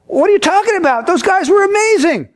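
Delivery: high-pitched, disbelieving rave; incredulous enthusiasm. Acted. Concertgoer to deaf man after the show, incredulous. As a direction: play a fan astonished anyone could miss it.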